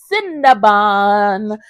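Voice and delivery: very slow, stretched-out name; savoring the plush's name; drawn-out name. Real, not acted. Names the plush with a flourish.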